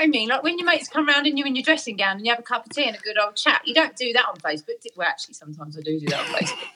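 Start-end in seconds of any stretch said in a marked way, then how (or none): 5.0-6.7 fast, quieter, laughing at herself; laughing admission. Real, not acted. Catching her own contradiction, laughing quietly to a friend. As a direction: giggle through the confession.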